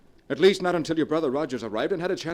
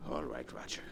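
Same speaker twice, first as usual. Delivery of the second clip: much lower and softer, giving up; defeated murmur; 12 semitones lower, 10 dB quieter than usual. Acted. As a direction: hand it over resignedly. Handing over the gun, defeated.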